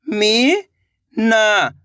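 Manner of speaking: Meena drawn out very slowly, each sound held. Shown as a demonstration. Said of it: "तो मिलके बन जाएगा". (मीना को बहुत धीरे खींचकर बोलें)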